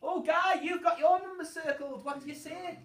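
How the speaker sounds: put-on high, loud praise for a child; excited teacher cheer. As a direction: cheer the kid on.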